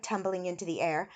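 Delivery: plain reading voice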